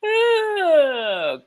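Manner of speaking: put-on high, loud delighted baby "Oh"; a dad imitating his baby's happy squeal. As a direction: squeal it like an excited little son. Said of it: "He stares at me and goes".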